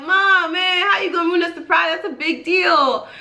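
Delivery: high, whiny retold reproach; dismayed complaint. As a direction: act out a daughter protesting a ruined surprise. Retold scolding for ruined surprise.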